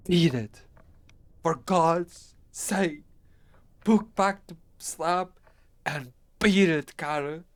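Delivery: loud, high, desperate drawn-out pleas; dying friend's last desperate cry; piteous cry. Acted. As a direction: scream at him to run. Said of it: "a piteous cry from Warren".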